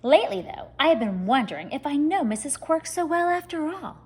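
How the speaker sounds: usual speaking voice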